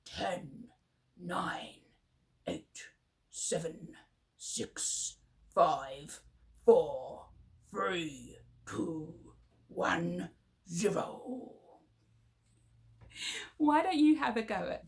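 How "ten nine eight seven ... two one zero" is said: funny voices. Shown as a demonstration. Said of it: "All together, funny voices".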